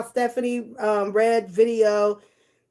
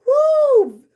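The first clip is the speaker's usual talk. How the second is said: whoop; 6 dB louder than usual. Real, not acted. Whoops at chat.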